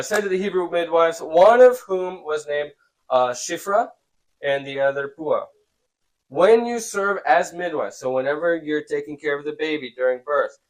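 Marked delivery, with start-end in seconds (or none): 0.0-8.0 higher, louder, slow read-aloud; scripture reading. A narrator's reading. Teacher reads the midwives' story to students, deliberate reading. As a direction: read each phrase out deliberately.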